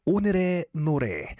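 오늘의 노래 said quickly in native Korean; Korean pronunciation. Shown as a demonstration. DJ telling listeners the segment's Korean name, Korean pronunciation. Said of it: "In Korean, we call it".

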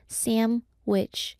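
"sandwich" with an m, no d, like "samwich". Shown as a demonstration. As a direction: blur "sandwich" into SAM-wich. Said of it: "we have to say".

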